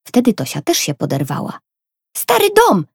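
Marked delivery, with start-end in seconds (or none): 2.2-2.9 put-on very high, loud girl's cry; excited shout. A narrator's reading. Little sister shouts the answer to the clue, high excited cry. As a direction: shriek it as the answer dawns. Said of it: "krzyknęła".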